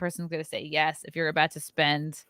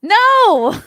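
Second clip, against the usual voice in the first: high, loud, shocked No!; 13 dB louder than usual. Real, not acted. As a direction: blurt it out in disbelief. Surprised denial to question.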